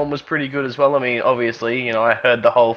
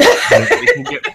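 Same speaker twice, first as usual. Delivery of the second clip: laughing; 4 dB louder, much slower than usual. Real, not acted. Joking about sponsorship, laughing.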